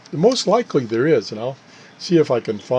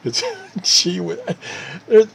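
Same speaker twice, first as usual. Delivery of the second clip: laughing; 6 semitones higher than usual. Real, not acted. Laughing at own hiccups.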